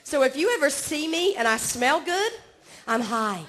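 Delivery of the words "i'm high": usual speaking voice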